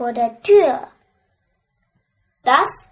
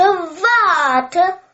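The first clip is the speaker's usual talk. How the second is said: repeated call, higher and louder; 6 semitones higher, 4 dB louder than usual. A narrator's reading. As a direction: call louder as she runs. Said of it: "ruft der Frosch".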